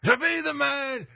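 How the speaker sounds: high, slow strutting catchphrase; Ric Flair impression.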